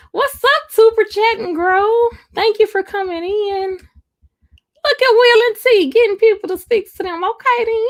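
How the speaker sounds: very high, playful squeal of a hello; silly greeting voice. Pretend. Streamer hailing viewers who come in, silly high greeting voice. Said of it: "It's cute how you talk to her".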